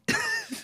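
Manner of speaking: sudden squeaky laugh, high and breaking; high burst of laughter. Real, not acted. Laughing at the Jet magazine joke, high burst of laughter.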